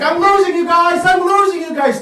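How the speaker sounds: high, loud panicked comic yell; Bill Burr. Retold or imitated. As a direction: shout it as a flailing stand-up comedian. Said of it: "he was like".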